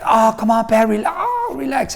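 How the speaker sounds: high, strained-sounding mock cries; mock pained pleading. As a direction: plead for calm while yelping in pain. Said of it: "and I'm screaming".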